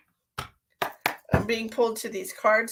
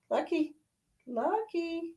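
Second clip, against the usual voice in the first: higher, sing-song call to a pet; high cat calling; 9 semitones higher, 7 dB quieter, slower than usual. Real, not acted. Calling her cat Lucky, high sing-song. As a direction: call the cat like a doting owner.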